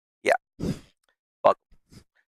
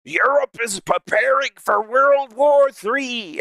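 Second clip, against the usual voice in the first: alarmist video voice; 8 semitones higher, slower than usual. Retold or imitated. Streamer quoting a scary war video, hammed-up alert. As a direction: ham up a dramatic warning.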